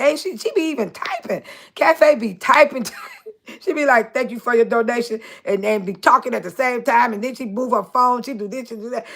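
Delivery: ordinary delivery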